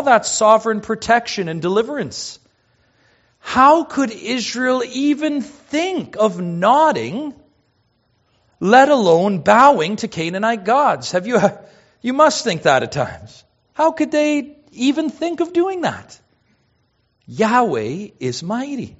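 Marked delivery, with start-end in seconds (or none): 3.4-11.1 high, drawn-out disbelief; how-could-they disbelief; incredulous. Acted. Voices the congregation's astonished question. 13.7-16.2 very high-pitched astonished question; astonished, how could they; incredulous. Acted. Voices the congregation's astonished question.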